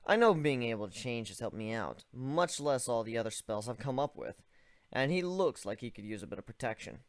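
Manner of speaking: ordinary delivery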